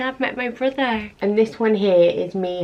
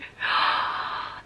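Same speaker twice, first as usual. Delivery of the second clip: gasp; 5 dB quieter than usual. Real, not acted. Opening another photo.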